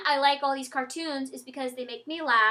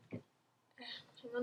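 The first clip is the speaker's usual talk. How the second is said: whisper; 2 semitones lower, 17 dB quieter than usual. Real, not acted. Quiet aside while searching.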